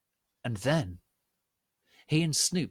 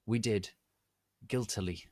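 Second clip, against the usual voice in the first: ordinary delivery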